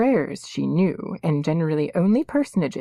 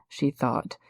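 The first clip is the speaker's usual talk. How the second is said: plain reading voice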